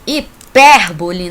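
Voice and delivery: "Hipérbole" slow and loud, punching PÉR; loud announcement of "Hipérbole", weight on PÉR; stress on second syllable. Shown as a demonstration. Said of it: "não é hiperbole".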